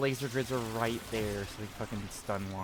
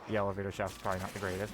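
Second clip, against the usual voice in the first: ordinary talk, nothing marked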